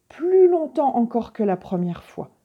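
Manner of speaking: ordinary delivery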